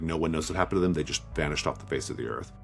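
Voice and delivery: ordinary talk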